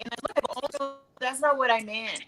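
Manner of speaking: ordinary delivery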